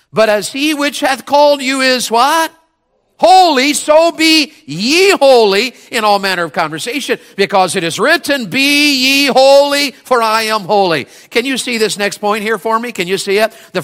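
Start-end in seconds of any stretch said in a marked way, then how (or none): 0.1-11.1 high, loud, slow on holy; fervent scripture reading. Real, not acted. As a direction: proclaim the verse to the church. Scripture read with rising fervour.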